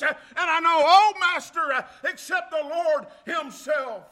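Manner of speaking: loud whooping preacher cadence, building; full preaching. Acted. Pastor exhorting the congregation in a loud preaching cadence. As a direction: preach it to the church at full force.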